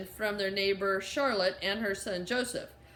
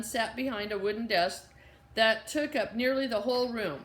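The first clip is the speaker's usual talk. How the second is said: usual speaking voice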